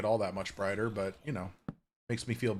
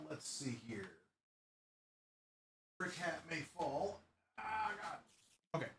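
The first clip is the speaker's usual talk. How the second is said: much softer, under the breath; muttering to self; 4 semitones higher, 13 dB quieter than usual. Real, not acted. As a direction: mutter while working. Muttering while placing things.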